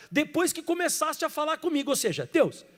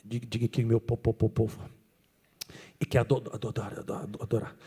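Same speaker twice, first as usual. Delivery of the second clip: much lower, softer, stammering on po- and ado-; stuttering Moses; 14 semitones lower, 7 dB quieter than usual. Acted. Pastor plays stuttering Moses before Pharaoh, low and stammering. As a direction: Moses stammers through his plea, low and soft. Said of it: "Eu vou fazer o papel de Moisés".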